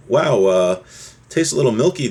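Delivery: ordinary delivery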